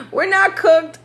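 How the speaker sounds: amused high-pitched protest; laughing denial. Real, not acted. Laughing at her own joke.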